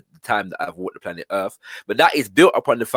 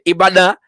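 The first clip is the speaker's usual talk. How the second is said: higher, louder, drummed-out Ibadan; Ibadan said rhythmically; 6 semitones higher, 7 dB louder than usual. Shown as a demonstration. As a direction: hit Ibadan with some rhythm. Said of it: "there's some rhythm in that".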